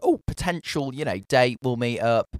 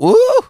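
impressed ooh; 11 dB louder than usual. Retold or imitated. Impressed reaction imitated.

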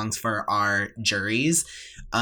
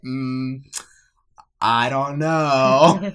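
drawn-out, hesitant doubt; doubtful; 4 dB louder, much slower than usual. Retold or imitated. Singer doubting the no-breath instruction, hesitant. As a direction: stretch it out, unconvinced. Acting out doubtful reply to teacher.

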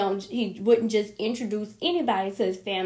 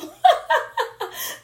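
high laugh; 5 dB louder than usual. Real, not acted. Laughing about outdated profile.

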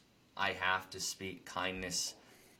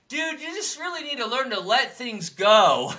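retold lecture, much higher and louder; preachy advice; 14 semitones higher, 11 dB louder than usual. Retelling what he told the biker, a mock preachy call. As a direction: lecture the biker to let things go, a touch mock. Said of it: "Me saying this".

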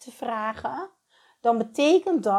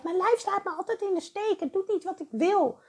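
high whiny complaining voice; whiny complaint; 10 semitones higher than usual. Retold or imitated. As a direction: complain about your body, high and whiny. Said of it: "niet vertrouwen op je lijf". (hoge zeurende klaagstem)

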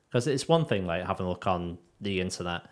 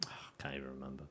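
breathy whisper; barely voiced, hushed; 7 semitones lower, 17 dB quieter, much faster than usual. Real, not acted. Whispered aside.

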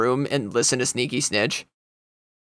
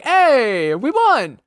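high, loud mock-celebration cheer; re-enacted win reaction; 12 semitones higher, 4 dB louder, slower than usual. Streamer re-enacts winning a Warzone game, high excited cheer. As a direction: squeal it out like a surprised win. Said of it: "it's just me literally being like".

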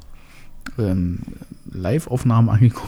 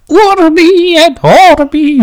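put-on high, slow, quavering old pope; frail old pope voice; 8 dB louder, much slower than usual. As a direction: intone it like a frail aged pope. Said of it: "dass ich schon etwas gebrechlicher werde".

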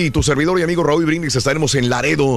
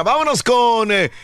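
high, slow, drawn-out announcer call; announcer shout; 9 semitones higher, slower than usual. Acted. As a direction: call the name out like a showman. Host announcing next segment.

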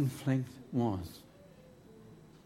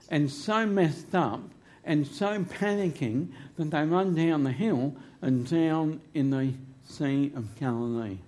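ordinary talk

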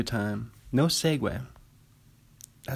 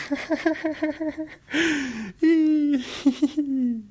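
shrieking laugh. Real, not acted. Sees the giant burger.

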